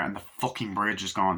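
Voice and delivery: ordinary talk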